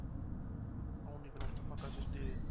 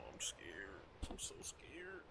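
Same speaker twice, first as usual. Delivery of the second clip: much softer, sliding into a whisper; scared whisper; 3 semitones lower, 11 dB quieter, slower than usual. Real, not acted. Shaken player after a scare, frightened whisper. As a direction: breathe the fear out.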